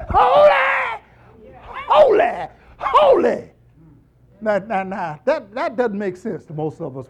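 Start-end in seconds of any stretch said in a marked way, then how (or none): 0.1-3.5 high, loud, ringing "Holy, holy, holy"; shouted holy. Shown as a demonstration. Preacher showing how the angels cry it out, shouting. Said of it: "And what they say, they say".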